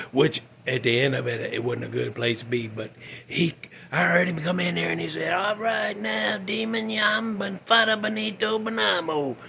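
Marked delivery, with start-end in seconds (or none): 5.3-9.4 lightly hammed-up preacher intoning his name; put-on priest voice. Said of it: "He don't, he didn't talk like that".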